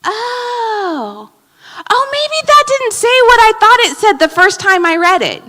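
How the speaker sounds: high, surprised oh of realization; realizing you misread. Retold or imitated. Rereading an email next day, surprised realization. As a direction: act out the dawning of a mistake. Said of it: "you're like".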